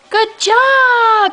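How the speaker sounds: high, gushing good job; excited high praise. Real, not acted. Praising toddler.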